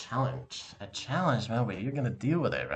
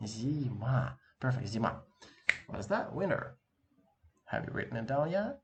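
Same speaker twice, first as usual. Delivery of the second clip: ordinary talk, nothing marked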